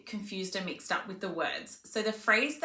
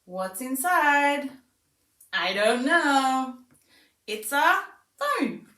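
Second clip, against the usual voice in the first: high, slow, sing-song wonder; slow teasing sing-song for a toddler; playful demo to child; 5 semitones higher, 5 dB louder, slower than usual. Shown as a demonstration. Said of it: "So it becomes quite fun and repetitive".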